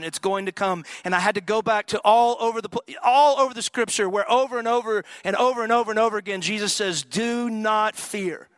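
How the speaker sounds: rising pulpit fervor; preaching. Acted. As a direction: preach it out with fire. Preaching at full force.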